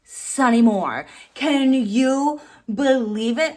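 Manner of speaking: ordinary talk, nothing marked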